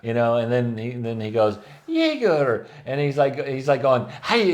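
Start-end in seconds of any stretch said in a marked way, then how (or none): 1.9-2.7 higher, sharp mock German call. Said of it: "acting like some German stooge". 4.2-4.5 higher, louder mock salute cry; mock Nazi salute. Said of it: "does like the Heil Hitler".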